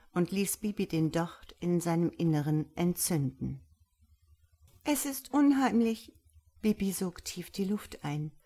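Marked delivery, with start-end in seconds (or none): 4.8-6.1 high, uneasy little voice; uneasy fear. A narrator's reading. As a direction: say it higher, spooked. Bibi fürchtet sich vorm Kürbis. (ängstlich, mit hoher Stimme)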